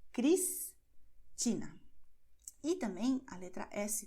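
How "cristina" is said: Cristina said very slowly, each syllable stretched; slow demo word. Shown as a demonstration. Teacher repeats the example for learners, very slow. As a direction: lay out Cristina slowly so the S is heard. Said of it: "que tem som de S".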